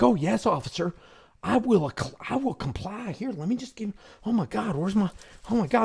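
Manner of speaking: hammed-up frightened rush to obey; mock panicky compliance. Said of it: "They're like".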